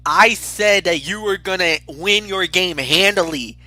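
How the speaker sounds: ordinary talk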